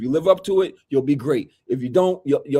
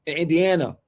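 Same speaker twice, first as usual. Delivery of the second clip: ordinary delivery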